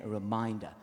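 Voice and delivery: ordinary delivery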